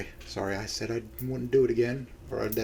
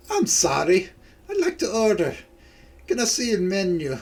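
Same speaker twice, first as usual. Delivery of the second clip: put-on Scottish accent, pitched up; 4 semitones higher than usual. Nessie repeating her order to the server, Scottish accent. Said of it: "I'll just do her lines one more time".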